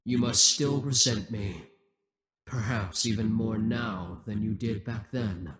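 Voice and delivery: ordinary delivery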